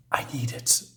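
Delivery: a breathy whisper to himself. Acted. Hero alone in the rain, whispering. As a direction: hush it out, barely voiced.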